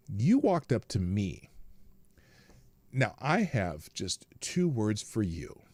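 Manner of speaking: slow, measured put-down; deliberate retold comeback. Lady Boomer answers the woman confronting her, slow and deliberate. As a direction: draw it out before the punchline.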